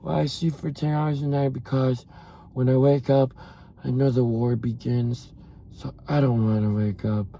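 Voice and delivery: low, self-pitying mimicry; mock gloomy sleeper. Imitating a follower explaining why they oversleep, mock gloomy. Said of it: "How does your body let you sleep".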